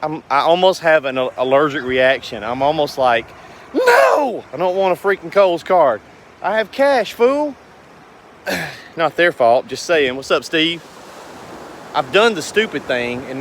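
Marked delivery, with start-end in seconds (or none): 3.7-7.5 exasperated refusal. Pretend. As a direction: pitch jumps way up on a fed-up no. Refusing a store card offer.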